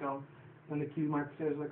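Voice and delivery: usual speaking voice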